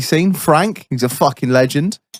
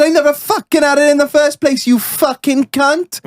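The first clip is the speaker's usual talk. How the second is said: put-on very high Welsh accent, drawing out the final insult; 13 semitones higher than usual. Welshman hotly denying he ever had it, Welsh accent. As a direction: deny it as an outraged Welshman. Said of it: "I'll do my best Welsh accent".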